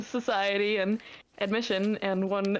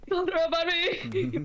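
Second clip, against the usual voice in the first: panicked yell; 8 semitones higher, slower than usual. Retold or imitated. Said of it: "So it's like".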